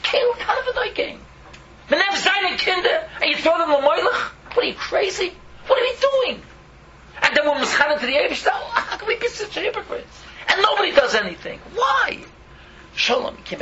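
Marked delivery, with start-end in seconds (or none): 0.0-1.2 pained repeat. Real, not acted. Repeats verse in anguish. 1.9-12.2 full-force fiery rebuke; outraged rebuke. Real, not acted.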